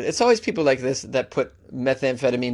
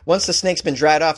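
ordinary delivery

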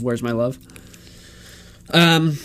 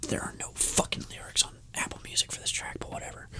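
whispered aside; 17 dB quieter than usual. Real, not acted. Aside about missing lyrics.

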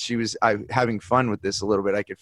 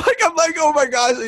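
much higher, laughing, stunned; laughing amazement; 4 dB louder than usual. Real, not acted. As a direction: laugh out the gasp of disbelief. Laughing at son's surprising answer.

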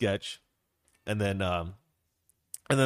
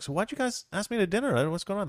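lightly acted puzzled question, higher and quick; puzzled guest; 8 semitones higher, faster than usual. Friend asks the couple why he was invited, puzzled. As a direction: ask as a curious dinner guest, pitch up. Voices Jeffrey asking hosts at dinner.

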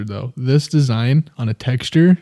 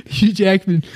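higher Aussie Hugh Jackman between giggles; attempted Australian accent; 6 semitones higher, slower than usual. Shown as a demonstration. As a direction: try the Aussie name while about to crack up. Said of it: "say it a couple times".

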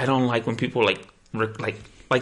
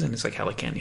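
usual speaking voice

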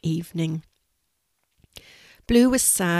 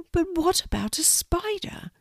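high, small, anxious spider voice; little worry in a high squeak of a voice; worried Quincy; 11 semitones higher than usual. Acted. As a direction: ask nervously as a tiny spider. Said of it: "still a little worried".

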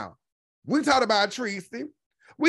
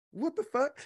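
soft, higher-pitched bafflement; hushed disbelief; 6 semitones higher, 5 dB quieter than usual. Retold or imitated. Commentator retelling what she was like on hearing it, soft high disbelief. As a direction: retell it as a small, stunned question. Retells own stunned reaction.

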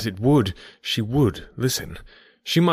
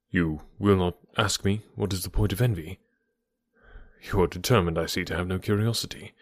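somewhat lower and softer, a hint of a serious older man; lightly voiced grave gentleman; 3 semitones lower, 4 dB quieter than usual. Knightley pressing Emma to hear him, grave low gentleman. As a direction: speak low and earnest, holding something back. Knightley's voice held across speech.

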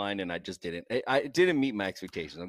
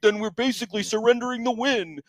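higher, put-on whiny voice; imitating a cohost's argument in a mocking higher voice; mock rebuttal voice; 10 semitones higher than usual. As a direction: play the cohost's silly argument. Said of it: "And I'm like".

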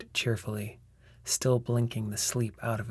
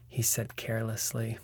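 usual speaking voice

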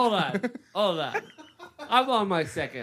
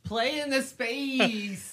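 put-on high, drawn-out New Orleans accent; mock New Orleans accent; 12 semitones higher, much slower than usual. Friend imagining being from New Orleans, high mock Louisiana accent. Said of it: "I would hate having that accent".

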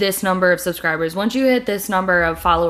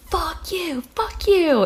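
retold yell; 8 semitones higher, slower than usual. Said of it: "I was yelling".

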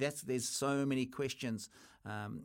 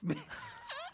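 hearty laugh; 5 dB quieter than usual. Real, not acted. Reacts laughing to co-host.

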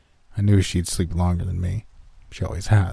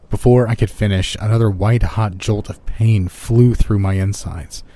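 usual speaking voice

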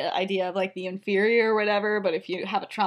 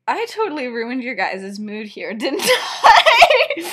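awkward apology dissolving into laughter; laughing; 3 semitones higher, 7 dB louder, slower than usual. Real, not acted. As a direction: blurt it out mid-laugh.

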